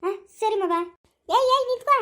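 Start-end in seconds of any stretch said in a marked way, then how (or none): 1.3-2.0 high, urgent call. Acted. Stopping a visitor who is leaving, calling out. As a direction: call out sharply to halt someone. Calls out to stop someone leaving.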